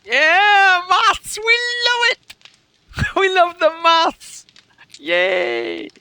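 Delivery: very high, loud, drawn-out mock cheer; mock crowd cheering. Streamer imitating viewers thrilled by a sum, mock cheering. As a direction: squeal it like an over-keen crowd, hammed-up. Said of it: "They're all like".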